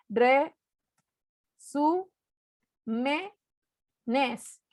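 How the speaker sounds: "Re, su, me, nes" in separate syllables with long pauses; isolated, evenly spaced syllables. Shown as a demonstration. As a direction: count out each syllable of "Re, su, me, nes". Divides word into syllables to count.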